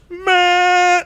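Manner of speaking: long, high, held honk; car horn imitation. Driver honking at woman holding up the line, blaring. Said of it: "put my hand on my horn".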